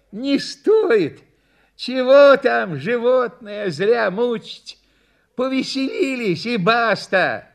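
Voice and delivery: high-pitched, easygoing refusal; villager dissuading. A narrator's reading. Onlooker tells the owner to spare the animal, dissuading. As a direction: call the show off kindly. Villager declines further circus act.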